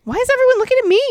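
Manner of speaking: high, loud anxious yammer. A narrator's reading. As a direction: blurt it out nervously. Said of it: "he yammered".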